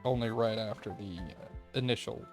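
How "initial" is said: usual speaking voice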